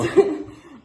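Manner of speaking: loud, high laugh; real laughter. Real, not acted. Teased about the anecdote, laughs loudly. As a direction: crack up out loud.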